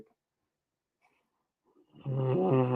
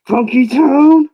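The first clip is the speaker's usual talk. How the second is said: high, slow, loud distorted demon voice; possessed voice; 13 semitones higher, 17 dB louder, slower than usual. Shown as a demonstration. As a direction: sing it out like something possessed. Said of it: "I sound possessed".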